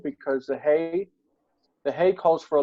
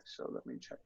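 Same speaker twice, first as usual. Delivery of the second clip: whispered aside while checking; muttered to self; 21 dB quieter than usual. Real, not acted. Teacher checks the file and whispers to themself while searching. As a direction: mutter it under your breath as you look. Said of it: "I wanna teach you right".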